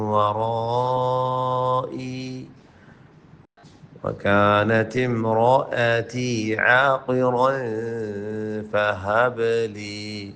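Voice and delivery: slow, sung tajweed recitation; Quran recitation. Acted. Teacher reciting the verse for students in a melodic voice. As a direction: chant the verse, drawing each word out.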